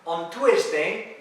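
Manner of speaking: slow "Tuesday" as too-ES-day; mispronunciation demo. Retold or imitated. Teacher imitating students in class, mispronounced. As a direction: draw out "Tuesday" with a T and a sounded E. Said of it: "Es que me dicen muchas veces".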